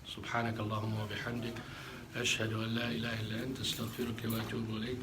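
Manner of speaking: fast, soft closing supplication; closing prayer, rapid. Real, not acted. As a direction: murmur the closing dua quickly.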